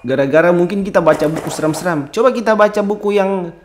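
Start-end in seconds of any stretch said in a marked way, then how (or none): none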